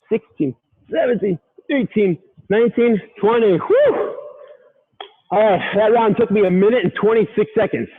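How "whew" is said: a high, relieved whoosh of breath; relieved whew. Real, not acted. Coach finishing round one blows out a relieved whew. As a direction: blow it all out after the last rep.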